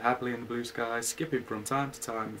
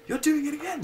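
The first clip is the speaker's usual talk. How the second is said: much higher, squeaky sad little bear; a hammed-up high whimper; mock-sad Pooh; 7 dB louder than usual. Pooh to Owl about the sneeze, high and sad. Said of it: "said Pooh, sadly".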